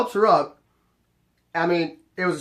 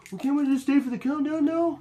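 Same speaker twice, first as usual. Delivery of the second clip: much higher mock plea; pleading husband; 10 semitones higher than usual. Husband begging doorman to stay for countdown, mock pleading. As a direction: imitate a husband begging, pitched way up.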